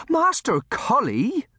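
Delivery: very high, loud, drawn-out exclamation; shocked cry. A narrator's reading. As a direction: cry out aghast at a child. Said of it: "she cried".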